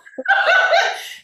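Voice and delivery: high squealing laugh; high burst of laughter. Real, not acted. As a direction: laugh out at yourself, high and loud. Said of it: "why my voice is all".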